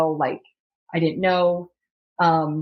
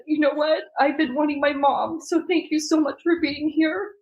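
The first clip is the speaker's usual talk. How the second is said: tearful thanks; 8 semitones higher than usual. Retold or imitated. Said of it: "And I said".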